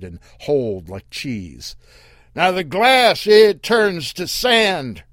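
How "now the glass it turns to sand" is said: high, loud yell. Acted. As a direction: call it out across the sand. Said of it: "Earl yelled".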